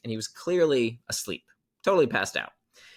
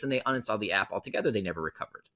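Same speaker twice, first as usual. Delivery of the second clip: ordinary delivery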